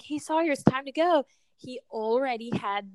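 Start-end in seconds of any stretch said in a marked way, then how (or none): 0.0-1.3 put-on calling child. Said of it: "I'd be like".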